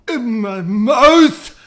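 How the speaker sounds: very loud, high, drawn-out yell; mock outcry. Food challenger defeated before tasting, loud mock outcry. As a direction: wail it, swelling on MOUTH. Mock cry echoing the previous words.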